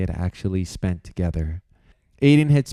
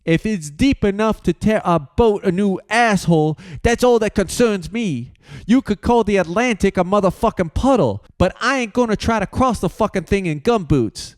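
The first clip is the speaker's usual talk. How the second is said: raised, higher voice, coarse and defiant; angry sailor's retort; 11 semitones higher, 3 dB louder than usual. Acted. George protesting the dangerous crossing, defiant. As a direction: spit it back like a fed-up crewman.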